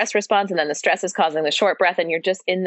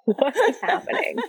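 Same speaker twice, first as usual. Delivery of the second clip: bewildered husband; 8 semitones higher than usual. Retold or imitated. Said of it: "he was just like".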